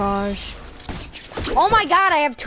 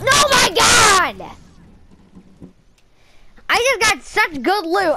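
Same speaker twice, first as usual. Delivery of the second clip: excited shout; 8 semitones higher, 3 dB louder than usual. Real, not acted. Excited at great loot.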